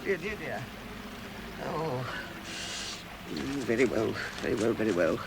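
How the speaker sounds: resigned muttering. Acted. Bilbo resigns himself alone in the dark. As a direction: give in quietly, to yourself.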